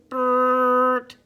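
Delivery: loud buzzing horn blast; horn honk. A narrator's reading. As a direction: blare it like a truck horn.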